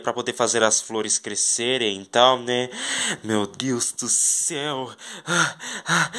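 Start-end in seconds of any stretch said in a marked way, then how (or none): 3.2-6.1 slow, softer whisper of wonder; quiet amazed sigh; awed. Pretend. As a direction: let it fall away in awe. Still amazed by the garden.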